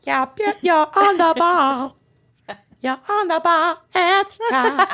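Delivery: high, laughing pop singer voice. Pretend. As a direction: pitch way up and giggle through it. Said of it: "You're both really good at it".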